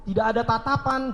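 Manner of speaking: ordinary talk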